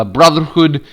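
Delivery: ordinary talk, nothing marked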